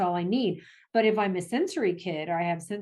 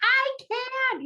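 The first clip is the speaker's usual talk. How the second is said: put-on upset kid yelling back. Child shouting back at a yelling parent, high and whiny. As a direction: imitate a kid who has hit their limit.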